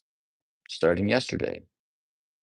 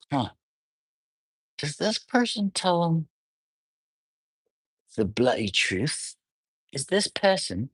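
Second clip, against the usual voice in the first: much higher, put-on storybook voice; bookish voice; 11 semitones higher than usual. Musing about a partner's honesty, in a high, theatrical book voice. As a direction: lift it high like reading a dramatic novel. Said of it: "I'm talking like that 'cause I was reading this book".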